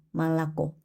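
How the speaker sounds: 말랐고 with unstressed o said as a; Russian 말랐고, reduced vowels before a stressed final o. Shown as a demonstration. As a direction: say 말랐고, both early o's as a, stress last. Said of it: "강세 없는 오는 아로 읽기 때문에".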